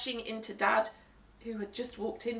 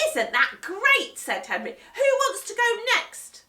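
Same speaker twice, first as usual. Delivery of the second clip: put-on higher, louder thrilled boy; delighted Henry; 13 semitones higher, 10 dB louder than usual. Henry thrilled with show, excited boy. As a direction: relish the chaos.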